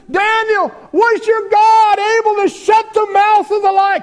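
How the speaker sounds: high, loud desperate plea; pleading shout. Acted. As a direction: shout his anguished question. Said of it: "this king is pleading".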